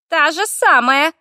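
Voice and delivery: high, drawn-out creaky grumble; grumbling fire elemental. A narrator's reading. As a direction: grumble in the little spirit's high voice. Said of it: "буркнула Стелла". (ворчливо, высоким скрипучим голосом)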